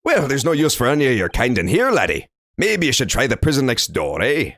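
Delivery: lightly voiced Scottish brogue, sneering; a hint of a contemptuous Scots jailer; Scottish governor. Governor dismissing the Japanese visitor in a Scottish accent. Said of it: "some sort of Irish or Scotsman".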